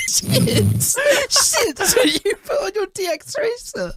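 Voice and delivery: crying with laughter. Real, not acted. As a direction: squeeze it out while tearing up laughing. Still laughing after the joke.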